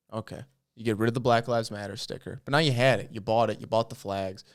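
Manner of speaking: ordinary delivery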